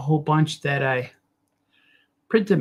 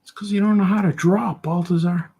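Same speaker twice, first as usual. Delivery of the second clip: higher-pitched fault-finding voice; heckling critic; 3 semitones higher than usual. Acted. Critic blames the artist for a bad drawing, heckling voice. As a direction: needle the artist in a pitched-up character voice. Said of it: "hope that critic leaves me alone".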